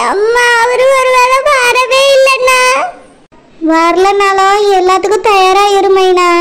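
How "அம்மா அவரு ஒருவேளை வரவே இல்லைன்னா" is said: tearful. Acted. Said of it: "நீ அழாத".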